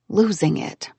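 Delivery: usual speaking voice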